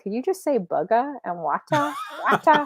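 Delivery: laughing, badly faked Boston accent; begging for the accent, then trying wata. Host admits she is horrible at accents. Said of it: "I can't even do it".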